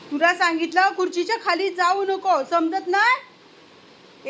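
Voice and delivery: high, loud telling-off; scolding. Real, not acted. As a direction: scold a naughty pet. Scolds pet under the chair.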